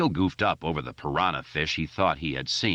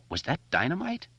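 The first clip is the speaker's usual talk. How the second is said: nervous; 11 semitones higher than usual. A narrator's reading. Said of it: "Beverly asked nervously".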